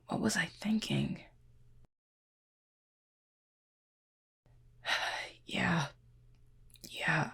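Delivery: soft and hushed, hurting; quiet hurt murmur; hushed pained whisper. Acted. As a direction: whisper through the pain. Hurt, tending wound, whispering.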